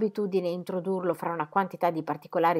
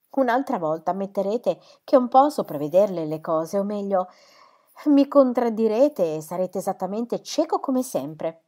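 loud, raised-pitch scolding; exclaiming reproach; 4 semitones higher, 9 dB louder than usual. A narrator's reading. Wife scolding her husband for his blindness, exclaiming reproach. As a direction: tell him off with vigour. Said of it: "esclamò missis Cadwallader agitando il braccio".

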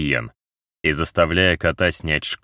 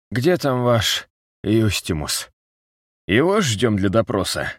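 battered man voice; 5 semitones higher than usual. A narrator's reading. Battered man asks for interrogator.

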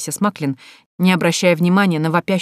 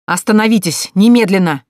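loud commanding shout; angry shout; 3 semitones higher, 7 dB louder than usual. Acted. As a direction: stop the blow with a furious order. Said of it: "Гневный окрик Лероя". (гневный окрик)

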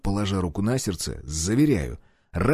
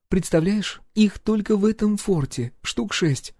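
higher lively woman's voice; wife voice; 10 semitones higher than usual. A narrator's reading. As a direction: tell him eagerly, as the wife.

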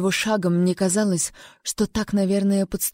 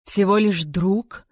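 incredulous; 6 dB louder, slower than usual. A narrator's reading. King presses incredulously.

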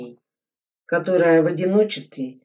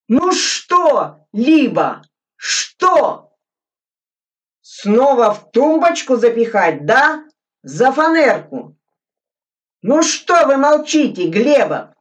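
loud, much higher shrill-edged yelling; angry shouting; 9 semitones higher, 8 dB louder than usual. Acted. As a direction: demand an answer, furious. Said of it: "тоже закричал Суслин".

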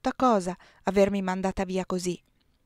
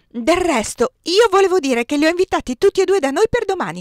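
higher and louder, pushing through; refusing to be silenced; 10 semitones higher, 8 dB louder than usual. A narrator's reading. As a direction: insist over the interruptions. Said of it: "non mi feci più zittire".

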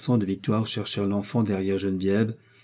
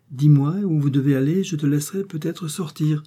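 higher-pitched, coaxing insistence; insistent; 6 semitones higher than usual. A narrator's reading. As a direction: press the child for an answer, pitched up. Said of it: "insista Geneviève". (insistant, d'une voix plus haute)

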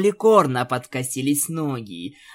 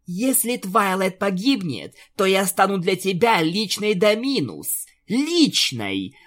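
higher, menacing warning; threatening; 6 semitones higher than usual. Acted. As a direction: threaten her, hitting the last word. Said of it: "Луна угрожала Альфе". (угрожающе)

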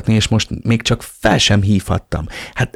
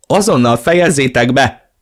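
high, frantic command; helpless tantrum; 5 semitones higher, 4 dB louder than usual. A narrator's reading. Elena orders the fighting men to stop, frantic. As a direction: demand it like someone stamping their feet. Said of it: "Toporzékolt mellettünk Elena tehetetlenül".

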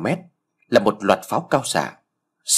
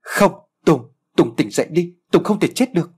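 screaming; 7 semitones higher than usual. Acted. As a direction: scream at him to wake up. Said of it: "Lan lay mạnh Tùng và gào thét".

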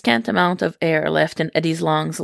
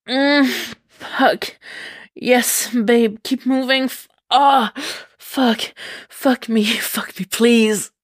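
moaning pleas; 6 semitones higher, slower than usual. Acted. Eddie begs Venom to keep going, moaning high and breathless. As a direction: beg him to keep going, gasping between words. Pleading moans during sex.

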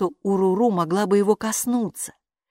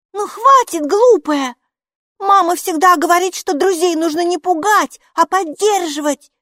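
high, loud, cross exclamation; angry girl; 13 semitones higher, 8 dB louder than usual. A narrator's reading. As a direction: scold her sharply. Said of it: "сердито воскликнула Майки". (сердито восклицает)

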